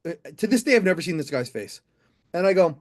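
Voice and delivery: usual speaking voice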